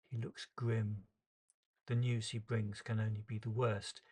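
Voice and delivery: ordinary delivery, nothing marked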